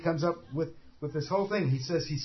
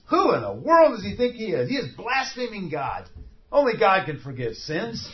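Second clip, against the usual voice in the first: louder, higher outraged protest; scandalised and affronted; mock outrage of the Pharisees; indignant Pharisees; 4 semitones higher, 4 dB louder than usual. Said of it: "the Pharisees got all excited".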